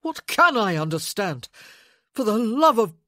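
loud, emphatic desperation; exasperated outburst. A narrator's reading. Man stopping short of blasphemy, bursting out. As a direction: let it explode, then cut off. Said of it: "he burst out".

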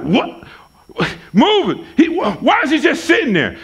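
shocked and pushing for an answer; alarmed jogger. Retold or imitated. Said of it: "the guy's like".

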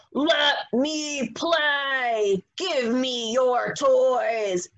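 slow, growly greedy wolf demand; drawn-out, bossy wolf voice; mean wolf voice. Acted. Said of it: "talking in his mean, angry voice".

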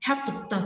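"helped" slowed, p and "tờ" released apart; đuôi -ed đọc /t/. Shown as a demonstration. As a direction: sound out the final t of "helped". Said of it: "chúng ta sẽ phát âm là". (đọc chậm "helped", bật rõ p rồi tờ)

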